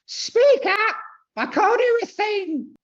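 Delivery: very high, loud comic screech; screeching Monty Python yell. Acted. Lady in film yelling at Jesus, high-pitched screechy yell. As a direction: yell it like an old auntie heckling from the back. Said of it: "She's yelling at Jesus".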